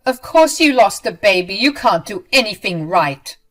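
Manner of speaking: loud, scornful attack; going off. Acted. Ramona mocking her loss, loud and cruel. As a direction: lash out at her with contempt. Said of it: "started going off again".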